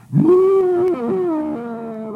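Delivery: a sudden high, loud sob-laugh; the tears break out of him; bursting into sobs. Real, not acted. Overcome, a loud sob on the phone call. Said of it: "I started bawling like a little baby".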